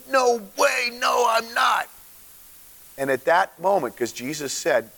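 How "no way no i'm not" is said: rude denial. Retold or imitated. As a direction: bark out the denial at the accuser. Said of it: "he cussed and got really rude".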